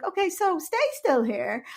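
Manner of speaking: mock doctor voice. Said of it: "they were like".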